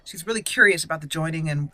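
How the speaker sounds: usual speaking voice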